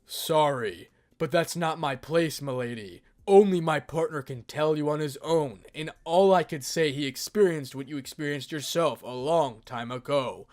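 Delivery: loud, booming dragon companion. Acted. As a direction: speak up like a proud dragon. Drake answers Lady Death.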